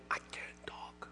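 whispered, almost no voice; voiceless whisper. Retold or imitated. Pastor showing how his lost voice sounded, a whisper. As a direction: rasp it out with a lost voice. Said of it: "wake up in the morning".

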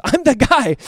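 loud, high proclamation; emphatic declaration. Acted. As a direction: announce it as the blind man. Blind man declaring his identity.

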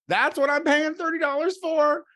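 ordinary delivery

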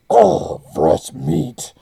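slow, loud demon growl; the Butcher. Retold or imitated. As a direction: drag it out like a hungry demon butcher. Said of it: "I swear his voice is doubled in volume".

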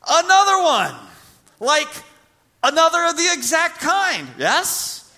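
shouting sermon climax; climactic preaching. Acted. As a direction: drive the point home at full force. Peak of the illustration.

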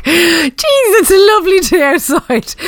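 high, chirpy Irish small talk; cheery Irish greeting. Retold or imitated. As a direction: sing out about the lovely day. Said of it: "she'd come in every day to work".

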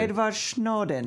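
slow mock Russian accent. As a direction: say the name like a Russian spy. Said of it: "Is that your Russian voice?".

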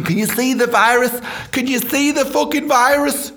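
high, incredulous lookout cry; mock urgent alarm. Mocking everyone told to look out for the virus, high alarm. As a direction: call it out in exaggerated vigilance.

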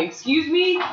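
high, rising disbelief; incredulous. Real, not acted. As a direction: ask it in outraged disbelief. Outraged at a squash match.

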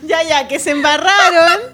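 loud laughing words. Real, not acted. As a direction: laugh through the line. Laughing at mistake.

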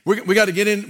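usual speaking voice

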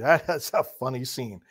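ordinary talk, nothing marked

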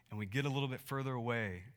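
ordinary talk, nothing marked

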